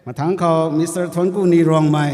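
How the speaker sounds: formal roll-call announcement; calling out names. Real, not acted. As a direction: call the next name out clearly.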